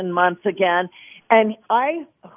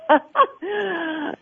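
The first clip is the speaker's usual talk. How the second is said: tearful laugh; 8 semitones higher than usual. Real, not acted. Overcome after telling mother's conversion.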